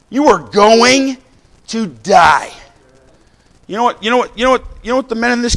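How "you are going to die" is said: slow warning. Acted.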